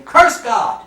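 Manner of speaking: sudden loud high cry; mock shout. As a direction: fling the words out as a shout. Friends urge Job to curse God.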